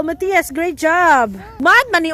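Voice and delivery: normal talking voice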